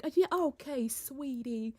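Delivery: hushed Southern sweet-talk; turned-on Southern. Shown as a demonstration. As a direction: turn on the soft Southern charm. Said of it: "turn on my Southern".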